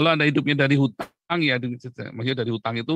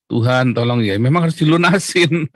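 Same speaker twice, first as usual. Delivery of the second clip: emphatic retort; 8 dB louder than usual. Real, not acted.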